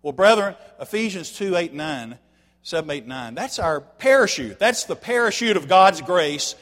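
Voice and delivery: full-force preaching. Acted. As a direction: drive the point home from the pulpit. Preacher builds to a climax.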